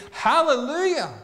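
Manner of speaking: high, loud cry of praise; joyful shout. Pretend. The pastor nearly shouts for joy at her story: loud, high hallelujah. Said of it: "I wanted to scream".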